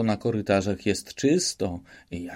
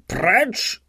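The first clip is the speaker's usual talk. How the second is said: yelled; 11 semitones higher than usual. Acted. Official throwing Szwejk out, furious shout. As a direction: throw him out with one bellow. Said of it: "wrzasnął na Szwejka pan o zwierzęcym wyrazie".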